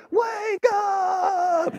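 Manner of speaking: very high, drawn-out, loud yell; imitated toddler yell. Two-year-old waking dozing dad right in his face, yelling. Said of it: "he just yells at the top of his lungs".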